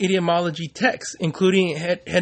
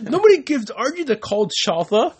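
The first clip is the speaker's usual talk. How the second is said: indignant outburst; 9 semitones higher than usual. Pretend. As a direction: play the offended tough guy.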